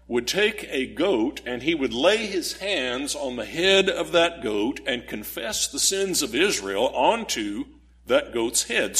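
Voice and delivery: ordinary talk, nothing marked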